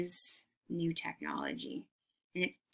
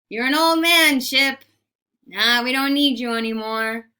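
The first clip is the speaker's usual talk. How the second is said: tugboat taunting; 4 semitones higher, 9 dB louder than usual. Acted. Tugboat voiced taunting old ship.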